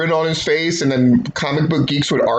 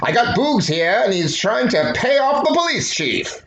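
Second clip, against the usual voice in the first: higher, hammed-up old-time detective voice; a comic-strip cop reporting in on his wrist radio; Dick Tracy; 9 semitones higher than usual. Dick Tracy talking into his watch, hammed-up detective voice. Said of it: "Dick Tracy's gonna talk into his watch".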